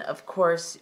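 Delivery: normal talking voice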